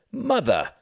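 high, sharply falling exclamation; mortified exclamation. Acted. Son sees his mother walk in, mortified exclamation. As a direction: exclaim it high, appalled at her. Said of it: "he exclaimed, his face mortified".

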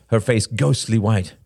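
ordinary delivery, nothing marked

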